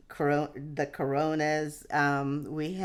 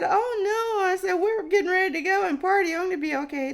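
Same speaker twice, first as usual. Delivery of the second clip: much higher, fast, eager; breezy refusal; 4 dB louder, faster than usual. Retold or imitated. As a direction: brush them off to go party. Refuses paramedics' rest offer.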